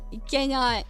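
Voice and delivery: ordinary talk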